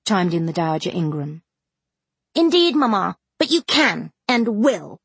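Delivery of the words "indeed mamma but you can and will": slow, haughty defiance to her mother; lofty and unyielding. A narrator's reading. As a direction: Blanche overruling mamma, haughtily. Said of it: "pronounced the haughty voice of Blanche".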